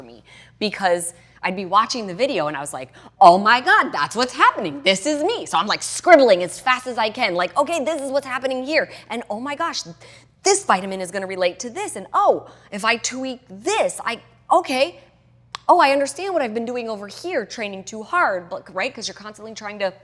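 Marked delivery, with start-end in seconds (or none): 3.2-5.5 high, loud burst of realisation; excited discovery. Retold or imitated. Watching the course video, she realises this is her: loud excited gasp. 7.6-18.5 excited realisation. Retold or imitated. Scribbling notes, realising things.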